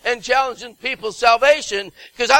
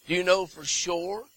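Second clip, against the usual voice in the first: ordinary talk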